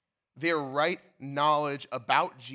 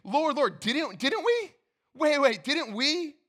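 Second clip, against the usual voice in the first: higher, louder, stammering in confusion; confused desperate plea; 10 semitones higher, 5 dB louder, slower than usual. Retold or imitated. Pastor voicing rejected believers, confused and desperate. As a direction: plead as if suddenly unsure. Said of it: "feel the confusion in their voice".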